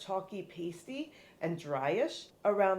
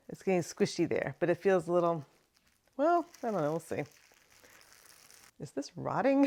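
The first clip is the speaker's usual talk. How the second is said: ordinary talk, nothing marked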